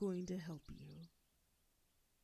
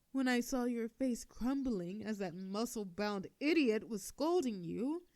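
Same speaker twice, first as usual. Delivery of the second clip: normal talking voice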